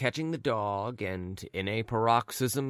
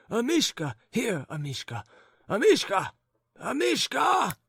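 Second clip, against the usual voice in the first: calling the dog; 12 semitones higher than usual. Acted. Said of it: "calling Amishka from under the bed".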